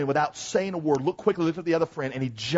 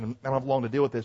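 ordinary delivery

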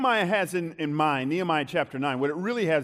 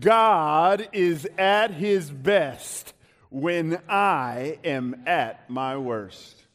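loud, slow, full-chested proclamation; big drawn-out declaration, falling at the end; 5 dB louder, much slower than usual. Shown as a demonstration. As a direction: say it with your chest. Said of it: "say it with your chest".

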